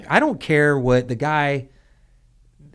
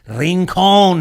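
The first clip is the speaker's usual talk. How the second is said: Rincon loud and high, in a hammed-up Puerto Rican accent; 6 semitones higher, 7 dB louder than usual. Imitating a Puerto Rican friend correcting him, exaggerated Puerto Rican accent on Rincon. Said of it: "I got a Puerto Rican friend".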